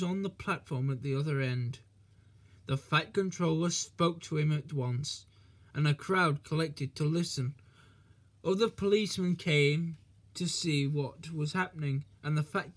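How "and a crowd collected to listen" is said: ordinary delivery, nothing marked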